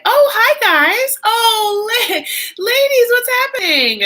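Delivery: high, loud, bubbly hello; drawn-out excited hi to the chat; excited greeting. Real, not acted. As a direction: squeal hello to the viewers.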